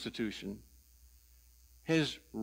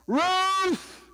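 loud, high shout for help; yell; 6 dB louder than usual. Retold or imitated. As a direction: yell the name out, drawn out and high. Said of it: "turned his head towards the house and yelled".

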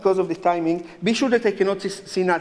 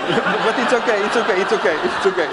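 laughing reassurance; 3 semitones higher, 4 dB louder than usual. Real, not acted. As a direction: chuckle through the okays, easing off. Laughing while reassuring volunteer.

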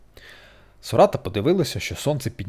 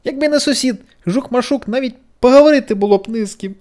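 high, louder pitying voice; self-pity; 13 semitones higher, 8 dB louder than usual. A narrator's reading. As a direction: feel sorry for yourself aloud. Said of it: "жаліла вона себе". (жаліє себе)

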